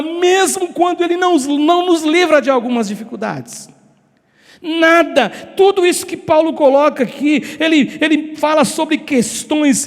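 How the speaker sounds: driving, insistent sermon with stretched key words; fervent preaching. Real, not acted. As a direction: preach at full force, piling phrase upon phrase. (pregação fervorosa, intensa e insistente)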